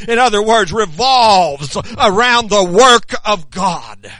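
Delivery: loud, hammering preacher's cadence; preaching at full force. Acted. Preacher rousing the congregation about proclaiming God's word, loud fervent preaching. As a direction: drive each line home to the pews.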